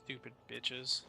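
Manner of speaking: quiet low grumble over the inventory; low grumbling. Real, not acted. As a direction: grumble under your breath. Grumbling over inventory after fight.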